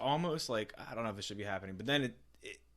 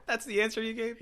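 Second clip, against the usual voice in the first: mock disbelief; 14 semitones higher, 7 dB louder than usual. Mocking disbelief at interview answer.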